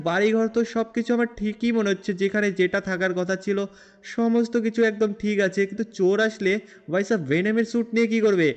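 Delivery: usual speaking voice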